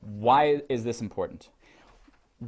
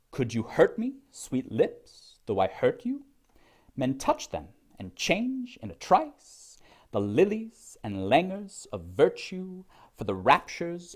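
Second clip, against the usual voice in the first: lilting verse in threes, every third syllable leaned on; slow, exaggerated metrical reading, drawing out the stresses; overemphasized stresses; 3 semitones higher than usual. Shown as a demonstration. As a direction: recite with heavy beats on HURT, LIPS, TOUCH, CHANGE. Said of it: "I'll overemphasize".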